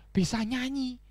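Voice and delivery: ordinary delivery, nothing marked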